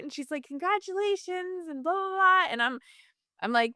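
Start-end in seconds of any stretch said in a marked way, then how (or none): 0.4-2.5 a higher, bright-put-on nurse greeting; imitating the nurse's chipper voice. Mocking the nurse who suddenly sounds cheerful on the phone. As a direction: lift the voice into a sing-song hello. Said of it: "different, different tone of voice".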